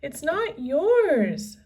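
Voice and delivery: high, loud, slow; angry shout. A narrator's reading. Annie defending the medallion, high loud shout. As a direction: insist fiercely. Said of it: "shouted Annie".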